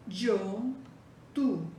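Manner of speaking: ordinary delivery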